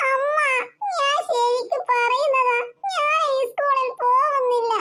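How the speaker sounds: put-on slow tearful little girl's plea; a child pleading through tears, slowly; crying child. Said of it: "നീ ഇങ്ങനെ കരയല്ലേ?". (കരഞ്ഞുകൊണ്ട് പതുക്കെ പറയുന്ന കുട്ടി)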